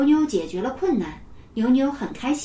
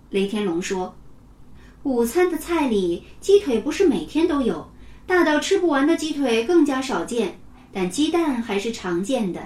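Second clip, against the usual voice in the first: ordinary delivery, nothing marked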